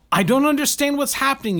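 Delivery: high, loud confused yell. A narrator's reading. The Baron to the others during the crash, a high confused yell. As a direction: shout your confusion over the noise. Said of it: "yelled the Baron".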